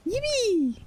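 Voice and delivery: high, delighted squeal of joy; excited cheer. Real, not acted. Streamer cheering at the game, a high excited squeal.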